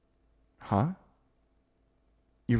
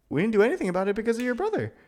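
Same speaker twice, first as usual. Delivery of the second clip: fast, high, louder burst of disbelief; rushed exasperation; 11 semitones higher, 3 dB louder, much faster than usual. Acted. To the friend, both crushing on each other, a fast high exasperated rush. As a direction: rattle it off, laughing at how dumb it was.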